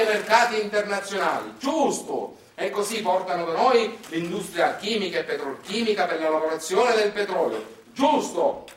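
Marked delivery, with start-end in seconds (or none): 1.6-2.4 high mock-approving exclaim; sarcastic giusto. Comedian mocks the industry's promises with sarcasm. 7.9-8.7 high mock-approving exclaim; sarcastic giusto. Comedian mocks the industry's promises with sarcasm.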